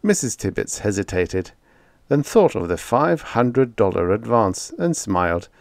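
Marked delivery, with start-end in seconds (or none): none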